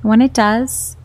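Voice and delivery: calm, unhurried meditation voice with long pauses; guided meditation. Acted. Host guiding stressed marketers through mindfulness, calm and unhurried. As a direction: guide gently, leaving space after each instruction.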